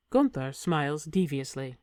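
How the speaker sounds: usual speaking voice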